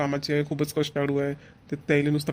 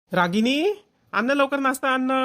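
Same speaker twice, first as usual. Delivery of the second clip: calling out; 7 semitones higher than usual. Acted. Brother shouting to his wife to bring breakfast, impatient. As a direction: raise your voice and call her impatiently from the next room.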